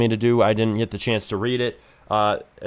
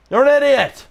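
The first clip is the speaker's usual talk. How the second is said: higher, louder yelled insult; mock shout; 12 semitones higher, 6 dB louder than usual. Streamer yells the insult at the AI NPC, mock shout. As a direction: bellow it back at her.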